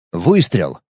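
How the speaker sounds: plain reading voice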